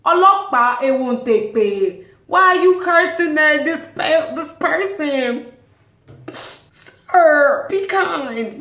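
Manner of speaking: loud, high-pitched mock protest; retold shouting. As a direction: call out in hammed-up exasperation. Acted shouting in traffic.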